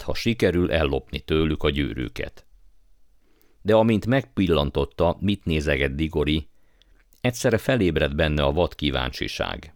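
plain reading voice

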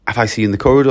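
ordinary talk

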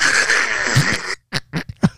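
wheezy laugh like choking on chicharron; choking Filipino laugh. Shown as a demonstration. Comedian showing host a Filipino laugh, choking cackle. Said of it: "like you're choking on chicharron".

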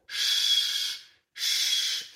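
long sustained shushing sound; teacher modelling the sh sound; hushing shh demo. Shown as a demonstration. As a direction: hold out a steady "shh". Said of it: "É esse som".

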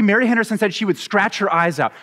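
ordinary delivery

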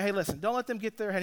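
soft, quick coaching aside; calming down. Retold or imitated. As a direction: quietly reassure an upset kid. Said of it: "So I calm him down".

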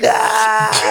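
high, put-on scream; imitated high cry of alarm; mock scream. Imitating his own terrified scream.

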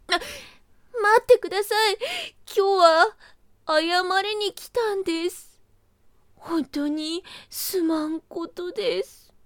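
high, sheepish rabbit voice, slowing down; bowing and scraping little rabbit; apologetic rabbit. Acted.

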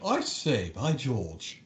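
hammed-up British exclamation; put-on English accent, jokey; mock English accent. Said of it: "more English than English".

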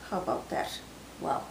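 ordinary delivery

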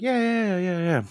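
high, loud mock cheer; mock enthusiastic. Narrator answering his friend Money, mock enthusiastic.

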